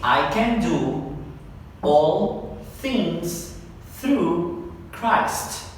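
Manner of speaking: very slow, phrase-by-phrase reading; slow drill of the verse; part by part. Shown as a demonstration. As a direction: stretch each chunk of the verse slowly. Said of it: "Vamos parte por parte".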